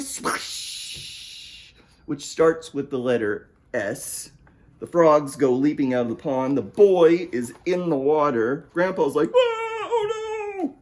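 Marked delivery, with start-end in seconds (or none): 0.0-1.7 whooshing, hushed water splash; splash noise. Acted. Imitating the splash into pond. 9.3-10.8 very high, drawn-out wail; dismayed grandpa. Acted. Grandpa sees boy fall in.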